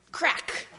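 high, sharp story sound effect; sudden crack. Acted. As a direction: burst out with the egg's crack.

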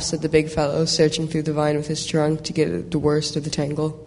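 plain reading voice

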